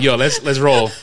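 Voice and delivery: loud, brash New York delivery; impatient New Yorker, played for laughs. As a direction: let the inner New Yorker out. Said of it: "Your inner New Yorker came out".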